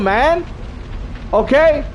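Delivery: ordinary delivery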